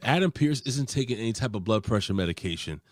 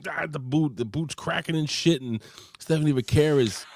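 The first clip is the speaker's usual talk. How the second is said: usual speaking voice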